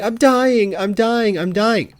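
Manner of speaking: loud, high mock cries of agony; hammed-up wailing. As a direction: play up a fake injury, loud and high. Mimics Malfoy's hammed-up injury wailing.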